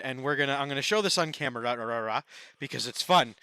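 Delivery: normal talking voice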